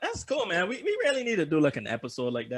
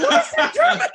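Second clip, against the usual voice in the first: much higher, louder mock girlfriend; shrill girlfriend impression; 12 dB louder than usual. Mocking his girlfriend's shocked question about German lyrics, high. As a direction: squeak out her outrage. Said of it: "She's like".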